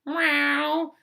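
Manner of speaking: high playful meow; a little cartoon-cat mew. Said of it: "They're like".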